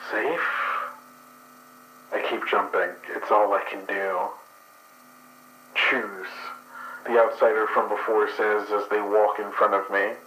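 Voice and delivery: ordinary delivery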